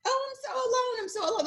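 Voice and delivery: high, mock self-pitying whine; mocking lament. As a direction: parody people moaning about being alone. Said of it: "you hear a lot of us saying".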